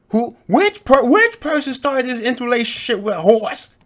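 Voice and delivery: high, loud incredulous shout. Real, not acted. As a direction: scream the question in disbelief. Rant peaks into shouting.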